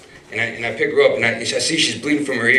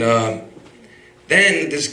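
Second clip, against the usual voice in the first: usual speaking voice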